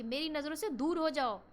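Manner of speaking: the right, angry way to say it. Shown as a demonstration. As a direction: throw them out straight away. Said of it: "तो ये strong emotions है".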